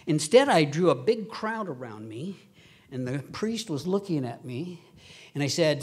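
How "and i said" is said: ordinary talk, nothing marked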